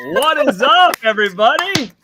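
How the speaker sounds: loud high stream greeting; hype greeting. Real, not acted. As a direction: pump up the viewers.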